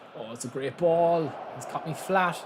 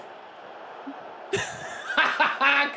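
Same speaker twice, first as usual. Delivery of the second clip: breathy laugh; 13 semitones higher than usual. Real, not acted. Laugh after protest.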